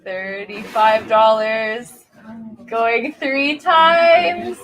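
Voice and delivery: slow, drawn-out going twice, sold; milk each count, then sing out sold; auctioneer countdown. Acted. Auctioneer closing the sale.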